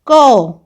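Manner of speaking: कौ said loud with a full open au vowel; clear au sound. Shown as a demonstration. Teacher modelling कौ for the class, loud and careful. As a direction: ring out the au in कौ like a model. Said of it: "औ की मात्रा".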